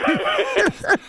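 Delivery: high giggle bursting out; can't hold back a giggle; stifled giggles. Real, not acted. Laughs at own joke.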